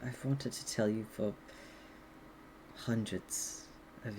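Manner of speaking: soft, low, halting confession; confessing dragon. Acted. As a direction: confess quietly, with long hesitant pauses. Game master plays confessing dragon.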